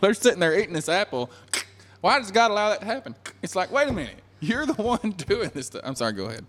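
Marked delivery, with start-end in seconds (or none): 2.0-3.2 high, hurried mock complaint; imitating a baffled complainer. Mimics complaining atheists eating apple. 3.7-5.8 high-pitched mock disbelief; acted, astonished objection; incredulous comeback. God's incredulous comeback to atheists.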